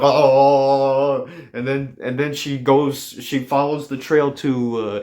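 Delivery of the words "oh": loud, long drawn-out "oh"; mock moan. Comic imitating wife after the drink, loud long moan. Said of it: "she's like".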